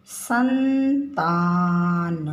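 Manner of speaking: संतान drawn out, ं held; teacher contrasting शतान with संतान; slow nasal demo. Shown as a demonstration. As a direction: stretch the ं in संतान. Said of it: "अब यहाँ लिखा है शतान".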